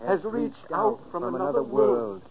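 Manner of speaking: echoing voice from beyond; ghostly echo. Acted. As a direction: sound distant and doubled. Voice from another world, echo.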